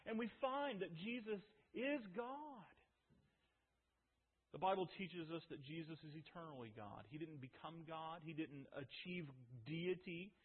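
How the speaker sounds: ordinary talk, nothing marked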